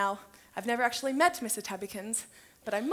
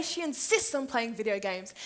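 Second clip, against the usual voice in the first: plain reading voice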